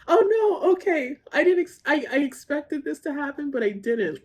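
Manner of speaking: real tears catching her mid-sentence; overcome, voice cracking; crying again. Real, not acted. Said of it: "I'm already getting emotional".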